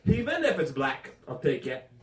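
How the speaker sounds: mother's thick French Canadian English; French Canadian accent. Retold or imitated. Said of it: "what she actually said was".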